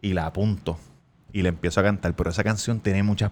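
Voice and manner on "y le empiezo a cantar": ordinary delivery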